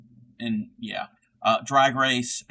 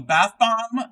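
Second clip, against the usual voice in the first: bright, higher, louder quote of bath bomb; quoted catchphrase; 9 semitones higher, 6 dB louder than usual. Acted. Streamer quoting a Trixie and Katya line in a higher voice. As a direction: toss out bath bomb as a high rising drag queen quote. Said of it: "So we often quote lines from them".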